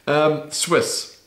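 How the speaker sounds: normal talking voice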